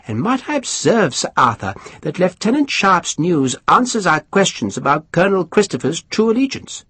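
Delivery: high, mannered lord spreading gossip; high aristocrat voice. Acted. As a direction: lift the pitch, savour the scandal. Pumphrey relishes the gossip.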